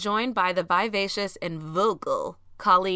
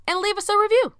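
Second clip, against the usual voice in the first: high squeal; 12 semitones higher, 5 dB louder, faster than usual. Acted. Pitched-up podcast plug.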